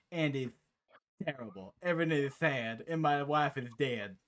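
loud, cheery, simple-minded big guy blurting his backstory; booming goofy tank who cheerfully reports tragedy; Lee impression. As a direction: play the hulking fighter as an oblivious, happy oversharer. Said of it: "I'll do my impression".

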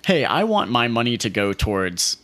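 usual speaking voice

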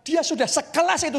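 ordinary talk, nothing marked

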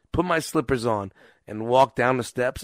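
ordinary talk

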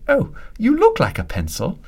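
put-on high child's voice, innocent and blunt; little boy voice. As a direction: voice the little boy's blunt remark.

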